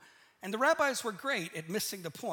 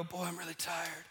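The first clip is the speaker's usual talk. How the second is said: humble brag; 5 semitones lower, 7 dB quieter than usual. Retold or imitated. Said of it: "you come in and you go".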